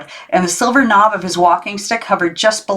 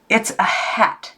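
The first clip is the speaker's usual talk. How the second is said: slow, cold and softer; icy; 3 semitones higher, 4 dB quieter, slower than usual. A narrator's reading. Sulky girl shutting down her uncle, slow and icy. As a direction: freeze him out with each word. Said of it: "she said icily".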